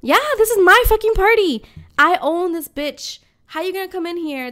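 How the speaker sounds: outraged host claiming her turf; indignant outburst. Real, not acted. Outraged about her party.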